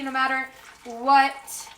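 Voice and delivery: normal talking voice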